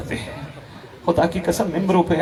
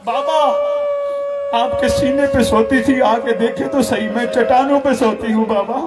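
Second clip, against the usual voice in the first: higher, crying plea to a dead father; weeping lament; 7 semitones higher than usual. Acted. Little daughter mourning her father, weeping high. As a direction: sob it out like a grieving little girl. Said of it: "रो रो के कहती है".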